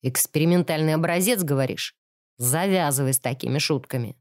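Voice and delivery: low, gruff and annoyed; angry deep voice. A narrator's reading. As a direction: growl it low like a big brute. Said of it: "сердито ответил громила глубоким и удивительно приятным голосом".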